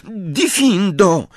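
high, drawn-out incantation; cried spell. A narrator's reading. Girl retrying the spell after apologising, crying it out high. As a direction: cry the spell in one unbroken, stretched word.

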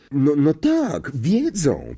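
slow, drawn-out fortune-teller voice; prophesy it, lingering on each word; a seer's ominous reading; mystic seer. A narrator's reading. Trelawney's reply.

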